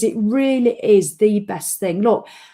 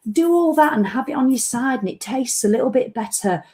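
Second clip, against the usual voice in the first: higher, sing-song lifestyle-magazine voice; mock magazine voice; 4 semitones higher than usual. Mocking magazine hydration tips to viewers, prissy sing-song. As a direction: gush it like a glossy magazine tip.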